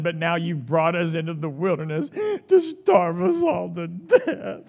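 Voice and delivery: mock moan through a laugh; laughing while mocking. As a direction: giggle through the whine. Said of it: "they moaned".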